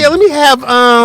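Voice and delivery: squeaky pretend order, high and loud; high mock customer voice. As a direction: play up a silly high-pitched order. Imitating Pam ordering.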